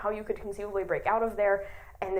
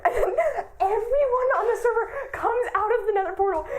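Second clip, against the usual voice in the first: laughing high through the words; squealing with delight; 10 semitones higher, 4 dB louder than usual. Real, not acted. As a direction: burst out giggling as you tell it. Gleeful retelling of the twist.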